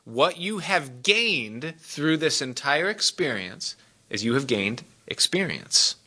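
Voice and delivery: slow, deliberate counsel; careful emphasis. Shown as a demonstration. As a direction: make every word land, gently but firmly. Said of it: "listen to me very carefully".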